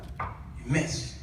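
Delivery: ordinary talk, nothing marked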